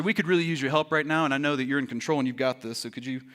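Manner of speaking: low, soft, quick polite request trailing away; mock calm request. Disciples asking their master for help, mock calm request.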